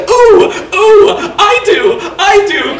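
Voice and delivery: put-on very high, loud child's squeal; excited cry. Tony begging Santa for the broomstick, high excited squeal. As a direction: jump up and down shouting it. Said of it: "cried Tony".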